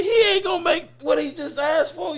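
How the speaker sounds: high, laughing disbelief; laughing incredulity. Real, not acted. To the interviewer, laughing incredulity. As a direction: laugh at how absurd it is.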